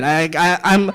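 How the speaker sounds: ordinary talk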